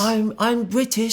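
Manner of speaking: lazy, hammed-up British accent; mock British accent. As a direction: ham up a British accent slowly. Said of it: "if we were gonna put on a British accent".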